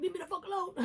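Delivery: high-pitched mock snap, half-laughing; mock cussing out. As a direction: toss it off as a playful fake cuss-out. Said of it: "cussing people out and being like".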